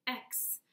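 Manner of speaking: an unvoiced "X" like ks, hissing; ks sound. Shown as a demonstration. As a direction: crackle then hiss like a snake.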